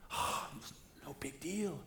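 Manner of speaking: mock dismissive whisper. Pastor voicing a gossiper shrugging it off, mock whisper. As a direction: breathe it like a careless gossip.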